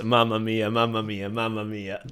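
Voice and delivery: mock Italian exclamation. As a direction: tease the Italian tourists with a stock Italian sigh. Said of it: "he's doing a bit".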